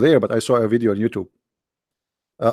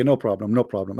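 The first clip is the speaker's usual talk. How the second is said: ordinary talk, nothing marked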